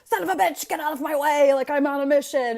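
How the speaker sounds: high, loud mock road rage. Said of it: "I was always like".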